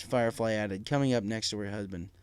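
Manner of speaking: ordinary delivery, nothing marked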